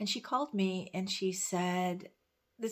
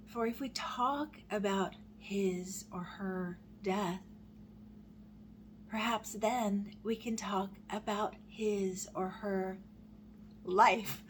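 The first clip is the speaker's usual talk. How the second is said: slow, deliberate recital; slow poem reading, landing hard on the last word; slower than usual. Acted. Reading a grief poem to viewers, slowly, emotion building.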